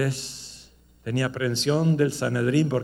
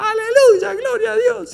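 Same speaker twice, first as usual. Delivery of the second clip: put-on high, loud cry of an old woman; old lady shouting; 9 dB louder than usual. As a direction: shriek it out like an elderly churchgoer interrupting. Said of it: "ella gritaba". (viejita gritando aleluya a todo pulmón)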